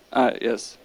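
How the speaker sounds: ordinary delivery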